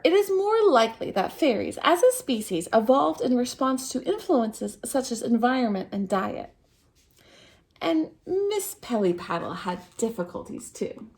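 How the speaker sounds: usual speaking voice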